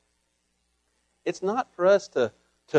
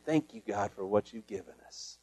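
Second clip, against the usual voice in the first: much softer and lower gratitude; humble thanks; 9 semitones lower, 12 dB quieter than usual. Shown as a demonstration. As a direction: say it quietly and sincerely. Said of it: "not whining, but saying".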